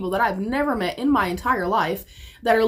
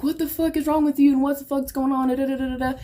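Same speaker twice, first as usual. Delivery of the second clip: higher-pitched, mock scolding rant; imitated angry sister; 5 semitones higher than usual. Retelling sister's angry confrontation.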